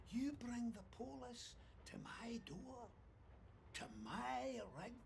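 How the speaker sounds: menacing whisper; whispered threat. Acted. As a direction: hiss the threat close. Boss menaces worker.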